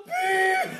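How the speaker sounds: whistle imitation.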